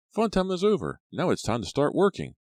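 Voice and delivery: high, bright-voiced rallying call; a teacher chirping that playtime's over; cheery advisor. A narrator's reading. Advisor calling the kids back to work, cheerfully high.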